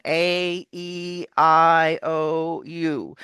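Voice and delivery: A, E, I, O, U as long vowels. Shown as a demonstration. Coach demonstrating long vowels for the student. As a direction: stretch each long vowel. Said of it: "So those are long".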